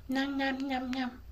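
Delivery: put-on soft baby gurgle sounds; baby babbling. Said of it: "he would just talk".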